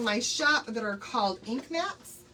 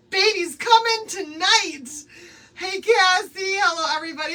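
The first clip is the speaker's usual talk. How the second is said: excited; 7 semitones higher, 9 dB louder than usual. Real, not acted. Excited greetings after news.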